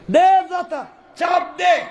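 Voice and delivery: high, urgent acted order; shouted command. Minister telling Abdullah to press, high shouted command. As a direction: call it out high and forceful.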